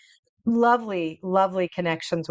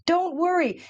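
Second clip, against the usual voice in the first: high, soothing voice of the cab driver; calm her down like a kind driver; the cab driver soothing a panicking Emily, high and gentle; reassuring cab driver; 8 semitones higher than usual. Retold or imitated. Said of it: "he would clean the car".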